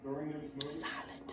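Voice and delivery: very soft, low, whispered weight; hushed. Real, not acted. Speaker dwelling on those sworn to protect, hushed. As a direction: drop to a near-whisper on one word.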